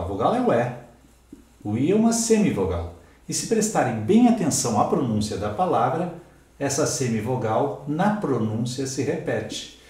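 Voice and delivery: ordinary talk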